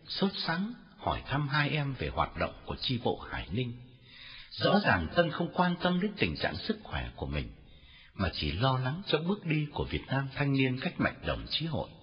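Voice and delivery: usual speaking voice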